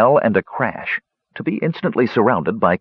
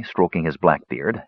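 ordinary delivery, nothing marked

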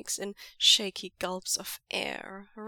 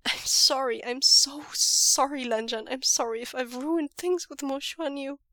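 hitching apology; 4 semitones higher, 7 dB louder than usual. A narrator's reading. Breathless apology after choking.

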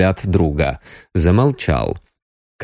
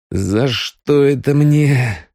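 slow, high, self-pitying wail; woeful lament; 7 semitones higher, much slower than usual. A narrator's reading. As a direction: bemoan your lot, stretching the words. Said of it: "пригорюнился Пищалов".

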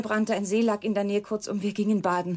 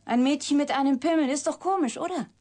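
bitter mockery; 7 semitones higher than usual. Confronting the shocked reporter with her secret, bitter mockery. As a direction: throw it at him with bitter irony.

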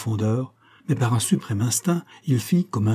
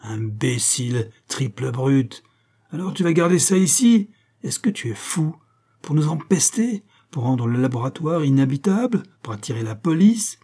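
angry; 3 semitones higher than usual. Acted. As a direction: berate him with exasperated questions. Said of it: "il était vert de colère". (réprimande en colère)

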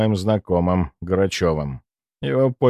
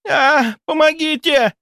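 high, slow shrieked cry for help; shrieking; 4 dB louder, slower than usual. Acted. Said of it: "завизжала девушка".

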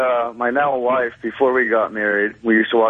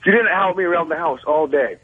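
mock wife complaint; 4 semitones higher than usual. Caller mimics wife's complaint.

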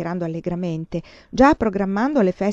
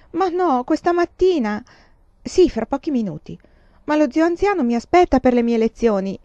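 put-on higher, louder pleading girl; protesting daughter; 7 semitones higher, 6 dB louder than usual. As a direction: plead against it, dismayed.

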